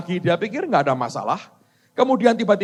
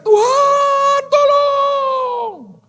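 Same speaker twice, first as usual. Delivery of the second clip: much higher, very loud desperate shout; desperate cry for help; 7 dB louder, much slower than usual. Acted. Man hanging over a ravine screams to God, desperate shout. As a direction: scream to God from the cliff edge. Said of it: "Dia teriak".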